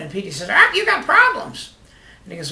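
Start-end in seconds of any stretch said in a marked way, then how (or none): 0.5-1.7 high squawky parrot voice. Acted. Joke teller voicing the parrot to its owner, high and squawky. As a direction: pitch way up like a talking bird.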